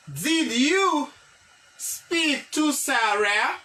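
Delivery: very slow with a pause after you; slow model sentence. Shown as a demonstration. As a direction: break the question apart slowly. (lekti trè dousman)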